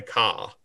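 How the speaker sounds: "ca" for car, with no R at the end; dropped R. Shown as a demonstration. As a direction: say "ca" with an open vowel and the R dropped. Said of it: "both someone from England and Boston will say".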